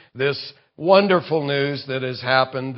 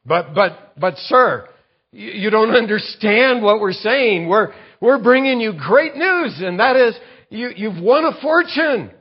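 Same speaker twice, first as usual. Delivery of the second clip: higher and louder, stammering out the good news; flustered pleading; 7 semitones higher, 4 dB louder than usual. Pretend. Messengers plead with the man pointing a gun, rising and flustered. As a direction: stumble over your words, desperate to be believed.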